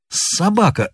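high-pitched, restrained angry insult; mild narrated fury; furious curse. A narrator's reading. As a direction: spit out the insult in a rage. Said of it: "заскрежетал он зубами".

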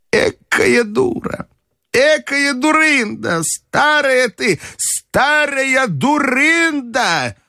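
put-on high, very slow old lady's lament; self-reproaching grandmother. A narrator's reading. Grandmother scolding herself on the way back, high and slow.

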